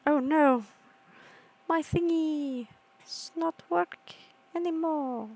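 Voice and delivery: put-on high, slow babyish complaint; high, slowed mock-sad voice; silly broken voice. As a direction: whine like a broken toy. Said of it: "I'll talk like this".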